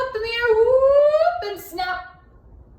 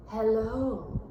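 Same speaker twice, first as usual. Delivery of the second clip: purring cat. A narrator's reading. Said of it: "purred the cat".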